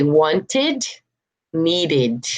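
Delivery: "wanted" and "needed" with a full -id; extra syllable. Shown as a demonstration. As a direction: say "wanted" as want-id, "needed" as need-id. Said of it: "add that extra syllable".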